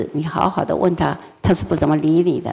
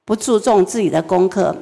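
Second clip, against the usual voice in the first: ordinary talk, nothing marked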